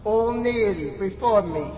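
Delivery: loud, drawn-out godly command; booming archangel voice. Acted. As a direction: boom it like a deity. Proclaims himself almighty god.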